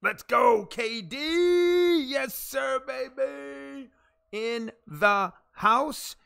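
loud, high, stretched-out announcer holler; hype shout. Real, not acted. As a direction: belt out a big arena welcome. Hyping a viewer's arrival.

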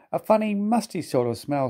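high, perky tank engine; cheeky little engine voice. A narrator's reading. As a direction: sniff it out with relish. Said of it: "said Thomas".

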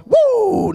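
very high wrestling-style woo yell; wrestler woo. Acted. Pastor teasing the blond usher with a high wrestler woo. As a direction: let out a ringing Ric Flair woo. Hyping the nature boy.

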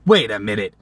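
ordinary talk, nothing marked

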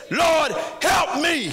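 slow, high, loud plea; desperate slow prayer; pleading cry. Retold or imitated. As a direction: cry out to the Lord. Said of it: "she simply said".

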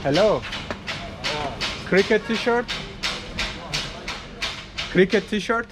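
normal talking voice

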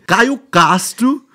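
loud, very slow headline read; drawn-out, punched-up title announcement. Acted. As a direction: blare the name out slowly like a headline. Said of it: "ó o título que vem".

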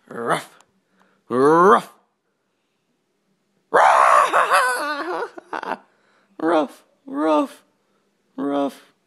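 high, loud yelping barks; playful loud dog impression. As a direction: yap at her like a frantic dog. Last try barking at her.

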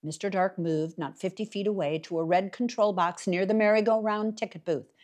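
ordinary delivery

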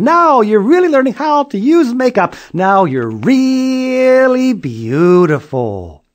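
high, loud, slow group praise; everyone praising. Acted. Family and mailman praising Julie, high loud slow. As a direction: gush with delight. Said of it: "and they all said".

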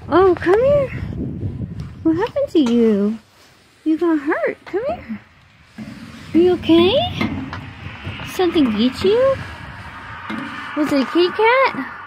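high, cooing questions to a dog; worried pet talk. Real, not acted. Owner asks if dog got hurt, high pet-talk voice.

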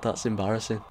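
ordinary talk, nothing marked

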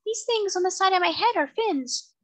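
very high, tiny creature voice; small, distinct, high-pitched voice; tiny seahorse voice. A narrator's reading. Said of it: "in a tiny but distinct voice".

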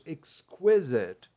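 exquisite said very slowly, ex-kwi-zit; slow classroom model of exquisite; slow model word. Shown as a demonstration. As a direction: drill exquisite slowly for students. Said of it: "Então é isso".

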